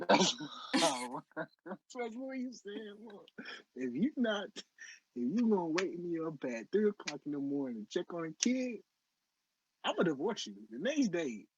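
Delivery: high-pitched, laughing through the words; can't keep straight face. Real, not acted. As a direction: crack up while repeating someone's threat. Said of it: "keep a straight face".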